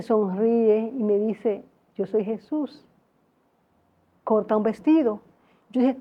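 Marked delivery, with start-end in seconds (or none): 1.9-5.2 calm gentle Jesus. Retold or imitated. Jesus voiced softly.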